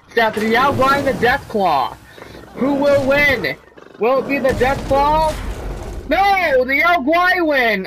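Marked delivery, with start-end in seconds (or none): 2.6-7.9 slow, rising arena hype; dramatic announcer. Acted. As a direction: build suspense like a fight announcer. Hypes the arena finale.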